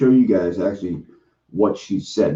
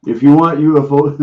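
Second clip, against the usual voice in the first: loud announcement; 5 semitones higher, 9 dB louder than usual. Real, not acted. Calling out to viewers.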